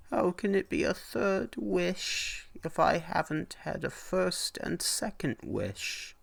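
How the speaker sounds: put-on puzzled, disbelieving old traveller; old man pushing back at the hag, high and baffled. A narrator's reading. Old man voiced in story.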